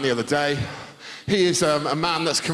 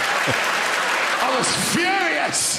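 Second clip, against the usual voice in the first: high, slow mock outrage; mock fury; 7 semitones higher, much slower than usual. Real, not acted. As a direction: drag it out, mock-furious. Mock outrage at Big Bird.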